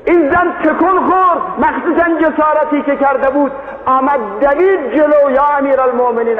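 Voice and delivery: pleading woman voiced in a full preaching cry; impassioned preaching. Preaching climax.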